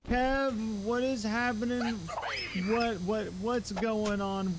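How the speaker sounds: high, delighted, calling out to Kev; light up as a friend drops in; excited greeting. Real, not acted. Streamer greeting Kev in chat, high and delighted.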